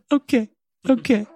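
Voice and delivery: tiny, very high squeak; mock meek agreement. Overwhelmed mother answering calm daughter, mock meek squeak. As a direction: squeak out a helpless little reply.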